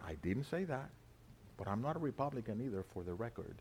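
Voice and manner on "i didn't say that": I didn't say that, much softer; quiet deflection, almost under breath; softly denying. Real, not acted. Preacher answering an imagined heckler, softly.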